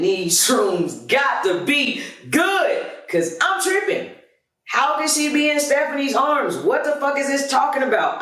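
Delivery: high, stretched disbelief; reeling as if the shrooms lie; stunned disbelief. Retold or imitated. Partner reads the texts, high stunned disbelief.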